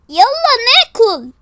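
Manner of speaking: high, loud, drawn-out call; stretched-out holler. Acted. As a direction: summon everyone to the food. Calling everyone to eat.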